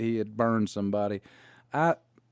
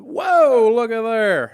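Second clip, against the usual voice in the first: much higher and louder, admiring whoa; impressed old wrestler; 10 semitones higher, 9 dB louder, much slower than usual. Retold or imitated. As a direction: Sam Houston marvelling at the boots. Sam voiced admiring boots.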